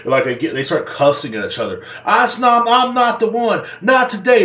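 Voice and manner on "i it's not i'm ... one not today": higher, defiant warning; hammed-up threatening shout; mock defiant yell. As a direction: dare the other one to try it. Drivers cussing at each other.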